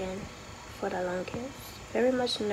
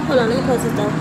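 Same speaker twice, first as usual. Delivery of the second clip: talking loud; 9 dB louder, much faster than usual. Real, not acted. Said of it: "but I'ma talk loud".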